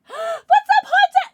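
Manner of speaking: high, loud squealed hello; excited greeting. Real, not acted. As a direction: squeal a welcome. Greeting a raider.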